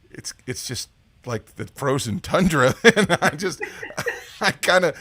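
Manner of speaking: amused, words breaking up with laughter; laughing. Real, not acted. Host joking about guest's move to frozen Chicago, laughing. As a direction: break into laughter mid-sentence.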